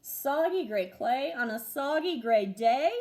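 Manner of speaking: ordinary delivery, nothing marked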